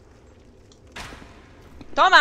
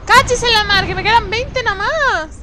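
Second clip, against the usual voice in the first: loud, higher, slow exasperation; exasperated shout; 10 semitones higher, 12 dB louder than usual. Real, not acted. Player out of arrows, shouting in frustration. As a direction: vent the frustration loudly.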